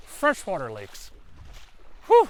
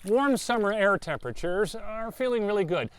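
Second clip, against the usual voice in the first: usual speaking voice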